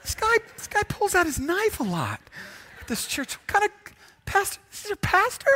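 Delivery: squealing mock disbelief over the pastor's knife; mock scandalized churchgoer. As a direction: play a shocked congregant gossiping about the pastor. Mock outrage at the knife-carrying pastor.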